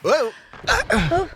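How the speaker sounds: grito agudo de susto ao perder o equilíbrio; startled whoa. Acted. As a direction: um whoa alto e assustado, a escorregar. Losing balance in bounce house.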